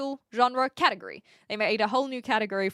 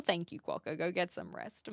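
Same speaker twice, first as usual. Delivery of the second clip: usual speaking voice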